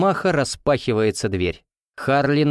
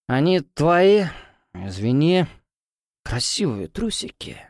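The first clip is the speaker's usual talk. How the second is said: put-on drunk frat bro; 5 semitones higher, slower than usual. Drunk guy apologizes.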